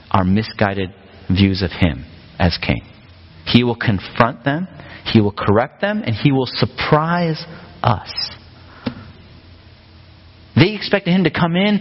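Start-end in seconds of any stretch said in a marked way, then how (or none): 3.4-8.1 slow, deliberate repeated "he will"; emphatic point. Real, not acted. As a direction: land each verb like a sermon point. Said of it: "sermon point emphasis".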